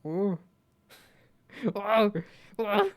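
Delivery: high, loud gnome "whoa"; high mock gnome hoots; imitating the gnome sound. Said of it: "It's such a specific sound".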